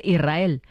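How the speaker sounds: "Israel" said as "Irrael", S dropped; silent S. Shown as a demonstration. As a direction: drop the S in "Israel", straight into a rolled R. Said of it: "sin que suene la S". ("Israel" sin pronunciar la S, como "Irrael")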